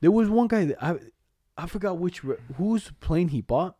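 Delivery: ordinary talk, nothing marked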